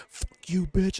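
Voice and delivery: high-pitched imitated on-court cursing; trash-talk impression. Imitating the player letting loose on court, mock trash talk. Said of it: "that's where you can, like, let loose".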